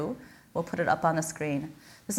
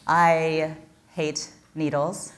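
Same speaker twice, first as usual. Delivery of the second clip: normal talking voice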